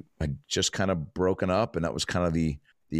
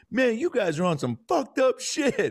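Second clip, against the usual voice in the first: high-pitched, slow, drawn-out amazement; onlooker's reaction. Retold or imitated. Stranger addresses the laughing friends after a pause, drawn-out reaction. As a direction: play a stranger's high, incredulous verdict. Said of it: "the guy just goes".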